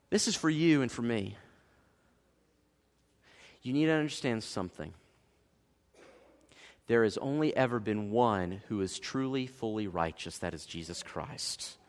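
low, soft, weighty sincerity; solemn and hushed. Real, not acted. Preacher gently telling congregation who alone is righteous, solemn hush. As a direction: let it sink down quietly, with pauses.

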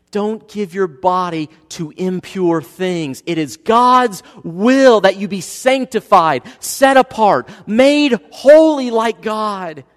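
loud, slow, high scripture proclamation; preaching at full force. Acted. Pastor proclaiming Thessalonians to the congregation, loud and slow. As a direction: lift the verse up slowly and loudly.

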